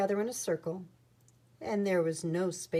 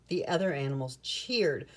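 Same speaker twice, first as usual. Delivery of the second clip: usual speaking voice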